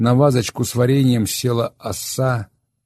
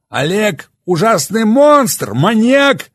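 challenging sneer, much higher and louder, slowed; defiant; 13 semitones higher, 8 dB louder, slower than usual. Acted. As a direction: throw the words back mockingly. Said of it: "с вызовом начал он".

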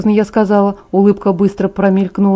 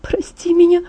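higher, timid plea for forgiveness; small apology; 10 semitones higher than usual. Acted. Guilty Bella timidly begs Jacob to forgive her. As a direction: ask for forgiveness in a small, high voice.

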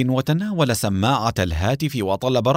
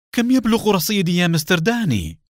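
much higher, eager, joyful cry; 9 semitones higher than usual. A narrator's reading. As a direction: blurt it out, thrilled and impatient. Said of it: "صاح حسين بمزيج من اللهفة والفرح".

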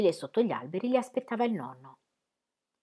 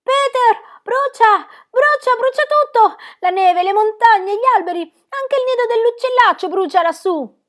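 high, loud alarmed shout; a child yelling high and loud at the red mountains; 12 dB louder than usual. A narrator's reading. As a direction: cry out that everything is burning. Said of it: "saltò su gridando".

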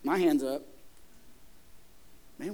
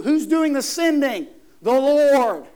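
loud, slowed question and booming answer; emphatic preaching; 4 semitones higher, 8 dB louder, slower than usual. Real, not acted. Preacher answering his own question to the congregation, emphatic. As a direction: drive it home, the answer loudest. Preacher drives the point home.

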